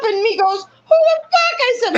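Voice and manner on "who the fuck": high-pitched mock outrage; higher imitated comeback, put out; retold indignant retort. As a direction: imitate someone indignantly snapping back. Imitates Michael's retort when told off.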